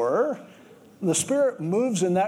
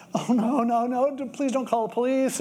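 panicked pleading; 6 semitones higher than usual. Retold or imitated. Caught man pleading with homeowner.

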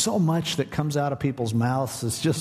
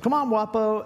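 higher-pitched coaxing call; calling a pet along; 8 semitones higher than usual. Retold or imitated. Man leaving the bench calls Wapo along, coaxing. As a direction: imitate someone calling a pet to come.

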